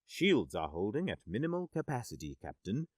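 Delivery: flat machine readout, no emotion; robot voice. Acted. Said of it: "its mechanical voice betraying no emotion".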